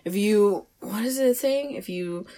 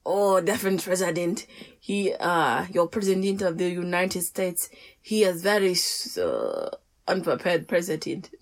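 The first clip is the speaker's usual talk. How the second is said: halting, badly faked French accent; amateur French accent that drifts off target; French accent attempt. Shown as a demonstration. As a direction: improvise as a Frenchman with an unconvincing accent.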